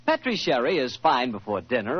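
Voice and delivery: ordinary talk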